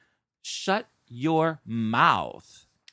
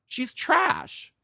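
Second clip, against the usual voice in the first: She's trash. with trash jumped much higher; high exclaim; 12 semitones higher than usual. Shown as a demonstration. Repeating the insult about the manager, pitched much higher. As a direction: fling trash up high in scorn.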